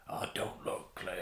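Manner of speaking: hushed, whispery impression of a man; awful character impression. Host imitating a character speaking to Clea, hushed bad impression. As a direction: badly imitate him in a soft whisper. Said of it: "That's an awful impression of him".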